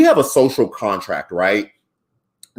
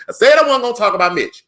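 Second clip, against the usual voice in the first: high, louder repeat scolding himself; playful exasperation at himself; mock exasperated self-scolding; 11 semitones higher, 4 dB louder, faster than usual. Real, not acted. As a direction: tell yourself off, exasperated. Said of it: "hold on, let me get off Mitch".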